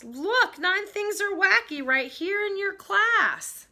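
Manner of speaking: high, urgent calling out; storybook yell. A narrator's reading. Pupil yelling to Miss Bass about class, story yell. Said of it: "I yelled to Miss Bass".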